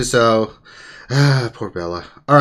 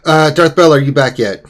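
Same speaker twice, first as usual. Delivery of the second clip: calling out; 4 semitones higher, 9 dB louder than usual. Real, not acted. Calling out to a returning guest.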